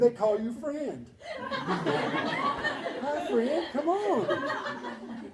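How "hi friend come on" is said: very high, drawn-out sing-song with a laugh; sing-song teacher voice. Retold or imitated. Imitating cheerful teachers greeting a kid, high sing-song. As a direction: coo it like a sugary kindergarten teacher. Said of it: "They're all sing-song voice".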